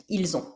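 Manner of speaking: soft, low "ils ont" as il-zont; quiet Z liaison in ils ont. Shown as a demonstration. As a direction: murmur "ils ont" with a Z link. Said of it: "on fait la liaison".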